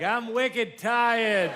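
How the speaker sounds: put-on high, drawn-out Boston accent; Boston toddler. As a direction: stretch it like a tired Boston toddler. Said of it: "Boston toddlers are like".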